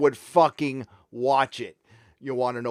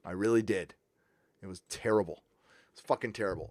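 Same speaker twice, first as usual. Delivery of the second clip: quiet disgust; 7 semitones lower, 10 dB quieter, faster than usual. Real, not acted.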